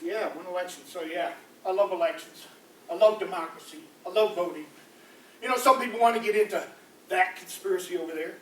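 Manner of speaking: normal talking voice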